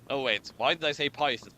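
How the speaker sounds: ordinary talk, nothing marked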